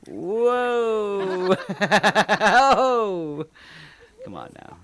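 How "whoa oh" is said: excited. Real, not acted. Thrilled reacting to curling video.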